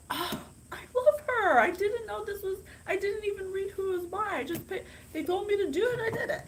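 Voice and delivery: thrilled viewer sharing a lucky find; excited gush. Real, not acted. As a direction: keep gushing in delight. Excited fangirling continues.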